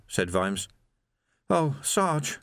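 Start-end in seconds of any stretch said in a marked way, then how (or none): 1.5-2.4 put-on higher, stricken young voice; a touch of tears, higher in pitch; tearful distress. A narrator's reading. Shaken young constable to his sergeant, tearful. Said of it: "Tears were running down".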